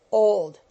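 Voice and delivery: put-on Old punched out loud and short; brief and emphatic. A narrator's reading. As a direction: snap the single word Old. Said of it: "briefly but emphatically".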